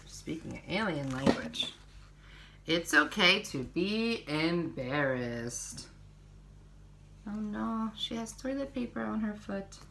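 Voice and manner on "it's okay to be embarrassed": slow, gentle picture-book reading; reading to children. Acted. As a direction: read each page to little ones, unhurried.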